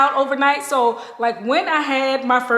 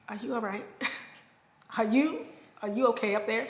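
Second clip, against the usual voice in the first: quiet concerned; 10 dB quieter, faster than usual. Retold or imitated. Friends' reaction quoted.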